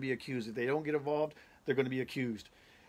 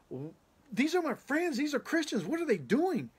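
much higher, stunned cry; shocked disbelief; 12 semitones higher than usual. Retold or imitated. Dreamer sees his friends in the mob, high shocked disbelief. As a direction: blurt it out in shock.

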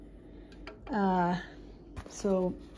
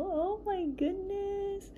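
soft, high, drawn-out gasp of delight; delighted cooing; 11 semitones higher, 6 dB quieter, slower than usual. Real, not acted. Collector first seeing her tiny doll, delighted cooing. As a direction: coo it slowly, high and soft.

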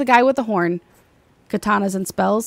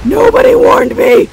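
panicked shout; 12 semitones higher, 9 dB louder than usual. Real, not acted. Panicked repeat.

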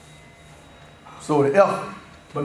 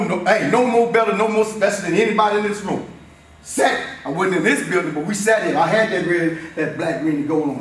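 normal talking voice

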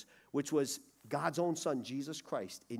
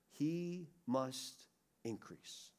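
ordinary delivery

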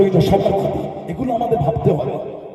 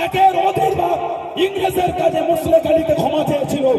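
fiery shout; 7 semitones higher than usual. Real, not acted. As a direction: thunder the accusation. Denouncing Savarkar at full force.

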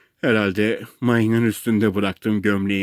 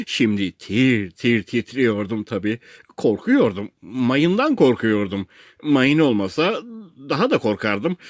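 plain reading voice

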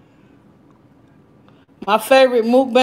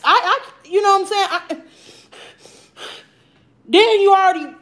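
high whiny complaint; 6 semitones higher than usual. Real, not acted. Complaining about mask.

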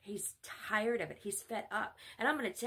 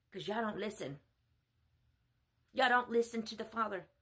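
ordinary delivery

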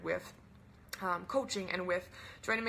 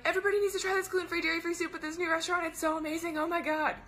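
high, fast, loud raving; gushing excitement; 8 semitones higher, 3 dB louder, faster than usual. Retold or imitated. Coach acting herself raving about soup to everyone, gushing excitement. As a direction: gush breathlessly fast like an over-the-top fan. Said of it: "I'm all up in there being like".

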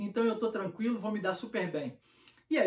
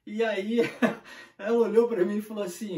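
normal talking voice

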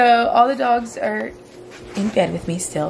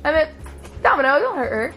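normal talking voice

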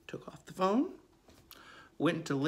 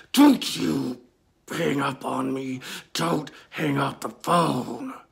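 louder growling demonic command; demonic yell; 2 semitones lower, 5 dB louder, slower than usual. Retold or imitated. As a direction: bark it like a demon. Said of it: "yelling at me".

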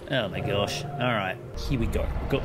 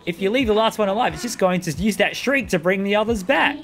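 higher, bossy imp companion; impatient imp; 6 semitones higher than usual. Acted. Gamer voicing the imp bossing the hero around, impatient. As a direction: scold and coach like a cheeky sidekick.

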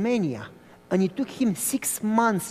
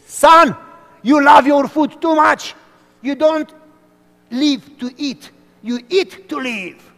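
put-on high, loud, booming old man's scolding; grandfather's lecture; 6 semitones higher, 10 dB louder than usual. Grandfather scolding his grandson about food, loud slow lecture. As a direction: lecture the boy slowly on food.

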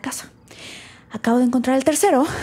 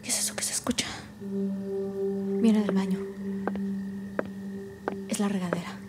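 hushed, uneasy half-whisper to herself; frightened whisper; 9 dB quieter than usual. Acted. Alone, wondering about the shower she turned off, frightened whisper. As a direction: keep it very soft and wary.

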